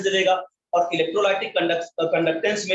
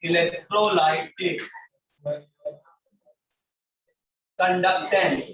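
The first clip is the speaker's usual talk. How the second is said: word by word with long pauses; writing-along pace; much slower than usual. Real, not acted. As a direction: say it in pieces, as if writing it out. Writing out the heading while saying it. (लिखते हुए, रुक-रुक कर बहुत धीमे)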